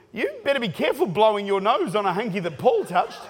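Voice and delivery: normal talking voice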